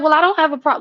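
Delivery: high, loud, taken-aback protest; defensive retort. Retold or imitated. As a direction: push back, indignant.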